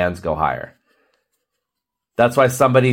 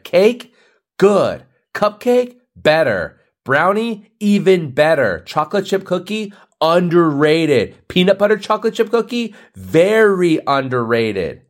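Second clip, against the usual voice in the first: mock ranking; 7 semitones higher than usual. Rating sweets list.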